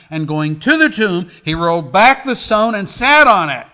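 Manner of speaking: loud, high Gospel proclamation; preaching scripture at full force. Acted. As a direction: ring out the account, punching each peak. Reads resurrection account with force.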